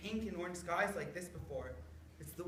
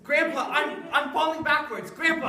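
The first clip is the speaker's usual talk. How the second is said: panicked cry; 7 semitones higher, 11 dB louder than usual. Acted. Boy tipping backwards with axe.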